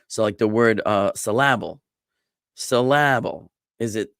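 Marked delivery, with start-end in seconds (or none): 1.2-3.5 "syllable" said as suh-LAB-ul; stress on LA. Shown as a demonstration. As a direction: punch the middle "la" of "syllable". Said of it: "emphasize the la".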